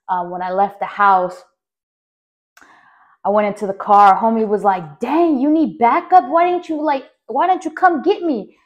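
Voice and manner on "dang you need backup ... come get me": much higher, louder disbelieving friend; surprised friend. Retold or imitated. Homie asking why he wasn't called, higher and louder. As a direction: voice the homie's shocked question.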